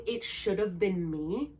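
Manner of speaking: slow and soft, a hollow admission of guilt; quiet broken confession. Retold or imitated. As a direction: let the line sink, softly and slowly. Feyre's line quoted again.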